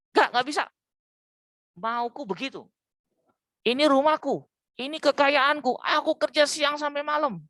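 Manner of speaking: indignant breadwinner insisting it's his house; stubborn husband. Acted. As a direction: snap back like a proud husband who won't budge. Voicing stubborn husband in argument.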